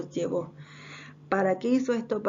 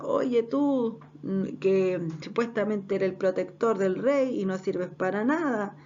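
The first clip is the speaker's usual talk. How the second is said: ordinary delivery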